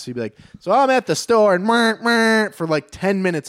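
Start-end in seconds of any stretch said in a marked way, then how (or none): 0.6-2.5 loud, raised stage voice cut by blaring horn noises; high, loud mock train horn; train horn interruption. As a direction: belt out the bit, then honk like a train. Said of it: "a train going by".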